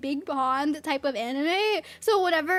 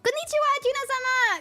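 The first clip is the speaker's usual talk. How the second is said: high, bright and quick anime greeting; excited greeting; 10 semitones higher, 4 dB louder, faster than usual. Real, not acted. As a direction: sing out a cheery hello to the chat. Cheerful greeting to chat.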